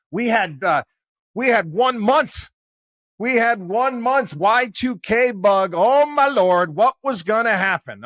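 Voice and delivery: high, loud, hammed-up dread; oh my God stretched in mock horror; mock doom panic. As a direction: gasp it out like the world is ending. Mocking Y2K doom panic.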